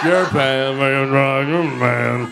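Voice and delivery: slow, drawn-out mock heckle; mocking retort. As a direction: drag the words out to tease her. Mocking bow-legged girls walking away.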